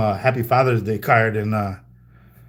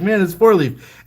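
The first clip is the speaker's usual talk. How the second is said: excited reveal; 8 semitones higher, 4 dB louder than usual. Retold or imitated. Revealing identity to startled fan.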